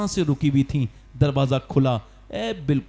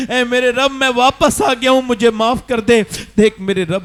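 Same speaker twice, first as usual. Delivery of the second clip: crying out, higher and louder, begging; loud plea; 7 semitones higher, 7 dB louder than usual. Acted. Dying son crying out to his Lord for forgiveness, loud plea. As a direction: cry out to God for mercy.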